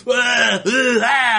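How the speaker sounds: high, hammed-up choking gasps; mock dying gasps. Puppet Popeye pretending to die at a bad smell, with hammed-up gasps. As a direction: fake a death rattle for laughs. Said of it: "he pretends to die".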